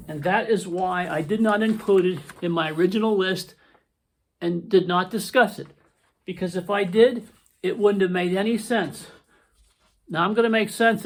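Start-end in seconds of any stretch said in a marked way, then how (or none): none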